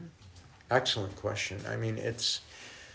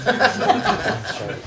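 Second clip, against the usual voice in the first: higher, slow, through a laugh; laughing apology; 12 semitones higher, 5 dB louder, much slower than usual. Real, not acted.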